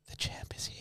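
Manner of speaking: creepy whispered line; retold creepy whisper. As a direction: breathe it into his ear. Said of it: "he just whispered".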